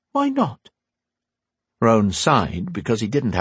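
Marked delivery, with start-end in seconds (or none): 0.1-0.7 lightly voiced older woman, higher; a hint of a wise old teacher; Windy's voice. A narrator's reading.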